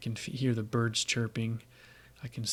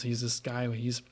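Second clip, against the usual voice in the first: usual speaking voice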